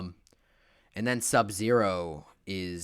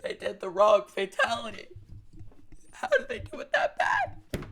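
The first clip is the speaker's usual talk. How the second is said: exasperated shriek; 13 semitones higher, 5 dB louder than usual. Real, not acted. Disbelief at the wrong fatality.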